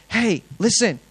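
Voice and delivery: high, loud call to the crowd; retold street preacher shouting to a crowd; open-air preaching call. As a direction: grab the market's attention. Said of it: "I should open air preach".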